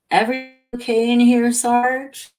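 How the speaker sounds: high, loud, quick questioning voice; young cop. Acted. Young cop checking on the Sarge, high and urgent.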